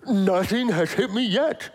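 slow, high put-on pet voice; cartoonish talking-dog voice, drawn out; little dog's voice. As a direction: speak as a naive little Shih Tzu. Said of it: "that's how she talks".

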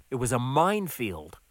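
usual speaking voice

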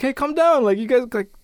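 mildly urgent mock reproach; acted-out intervention. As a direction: imitate someone calling off the teasing. One kid defends astronaut in sketch.

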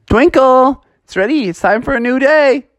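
high, loud morning call; calling out the window. Acted. Froggy calling Twinkle from the window in the morning, high and loud. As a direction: wake her from across the yard, up high.

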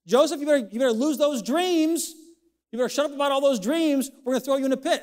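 high scolding taunt at Joseph; mock threat. Preacher voicing Joseph's brothers, high taunting. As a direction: push the warning at him like a jealous brother.

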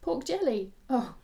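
high, loud startled pork jelly; a heroine startled into laughter; amused surprise. Acted. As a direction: catch her off guard into a laugh. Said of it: "The irrepressible laughter sprang into her eyes".

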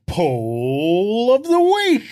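drawn-out, rising game-show announcer call; big loud build-up, stretched slowly; segment announcement. Pretend. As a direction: announce it like a jingle title.

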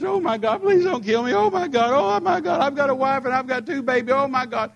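high, loud pleading for his life; mock panicked begging. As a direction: whine like a terrified coward. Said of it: "What if I just".

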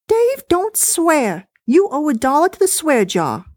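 dismissive, high and chatty; wife voice. Acted. Wife complaining to husband about the recording, high-pitched.

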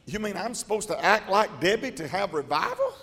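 normal talking voice

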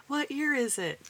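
high bewildered question; lost track of time, puzzled; confused. Retold or imitated. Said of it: "we'll be like".

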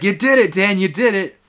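loud, high, thrilled yell; excited cheer. Retold or imitated. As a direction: celebrate the win out loud. Wade cheering Dan on.

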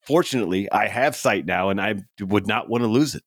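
ordinary delivery

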